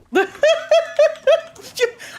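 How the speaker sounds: high, loud burst of laughter; real high-pitched laugh. Real, not acted. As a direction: crack up at the chat joke. Laughing at the brainworm.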